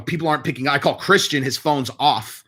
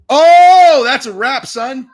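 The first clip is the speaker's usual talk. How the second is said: a much higher, much louder yell of delight; excited shout; 11 semitones higher, 10 dB louder, much slower than usual. Real, not acted. Watching the fight, he yells in high, loud delight. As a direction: roar at the knockout.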